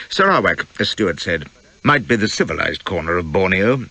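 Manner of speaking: ordinary delivery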